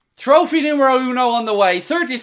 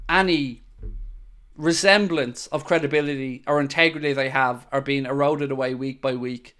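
quiet bitter; 10 semitones lower, 9 dB quieter, faster than usual. Real, not acted. Bitter verdict on the referees.

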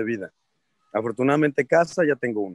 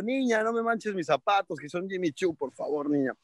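higher, fussy complaint about the shoes; snooty diva; 5 semitones higher, 4 dB quieter than usual. Retold or imitated. Telling the drooling fan off, snooty and fussy. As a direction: sound like a precious star. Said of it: "y yo".

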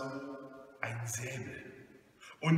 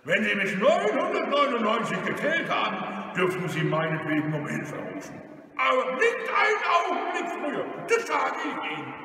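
loud, high blustering warning; bullying, raised robber voice; threatening robber; 7 semitones higher, 5 dB louder than usual. Acted. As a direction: warn the victim forcefully.

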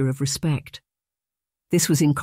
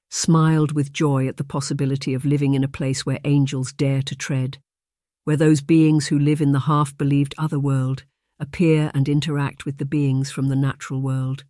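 usual speaking voice

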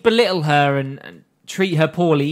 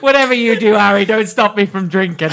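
loud, high hammed-up Irish; mock Irish accent; 6 semitones higher, 7 dB louder than usual. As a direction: play a boozy Irish wizard. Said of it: "our non-existent Irish contingent".

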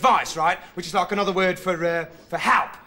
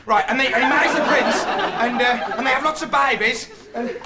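ordinary delivery

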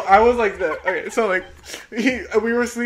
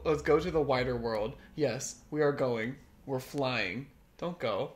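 low, soft read-aloud voice; deadpan reading; 4 semitones lower, 8 dB quieter than usual. Acted. Reading absurd tweets to her co-host, deadpan reading. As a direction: read it out quietly and solemnly straight.

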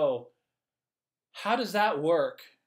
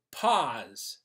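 firm pause; 3 semitones lower, 4 dB louder than usual. Acted. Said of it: "if they're getting a little heated".

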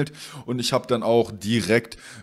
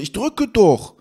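higher, louder imitation of protesting; mock indignant protest; 8 semitones higher, 5 dB louder, much slower than usual. Courier protests to customer at the door, mock indignant. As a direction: protest as if wrongly blamed.

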